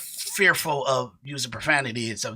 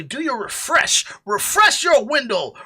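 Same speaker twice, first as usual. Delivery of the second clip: usual speaking voice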